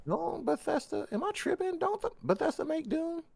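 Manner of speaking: high squeaky disbelief; surprised. Real, not acted. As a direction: voice jumps way up in shock. Sudden realization, high squeak.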